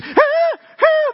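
very high mock screams of a kid on a ride; retold roller-coaster scream. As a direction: shriek way up high like a thrilled child. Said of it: "the whole way".

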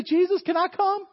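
high, loud, excited request; eager pleading. Acted. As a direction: beg like an over-keen child. Acts Peter begging to join.

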